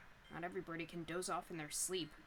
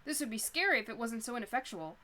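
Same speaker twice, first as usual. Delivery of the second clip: normal talking voice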